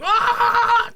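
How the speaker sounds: very high, loud cartoon scream; all-out mock villain scream; Vegeta. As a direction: let out a long, hammed-up shriek.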